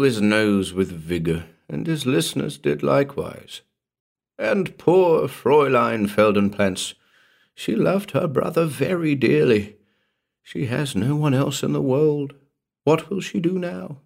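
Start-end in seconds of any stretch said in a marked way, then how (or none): 4.4-14.0 lightly voiced old tailor, a little higher; a hint of a sorrowful village gossip; grieving tailor. Tailor praising the dead man to listeners, mildly mournful.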